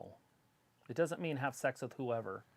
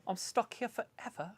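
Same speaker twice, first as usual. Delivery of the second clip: high put-on British accent; mock British accent; 8 semitones higher than usual. Friend joking he can never go home, mock British accent. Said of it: "Travis would totally pick up the accent".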